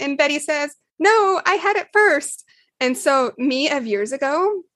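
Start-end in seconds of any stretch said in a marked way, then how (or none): 1.0-2.4 put-on child's protest. Sister answers in kid voice.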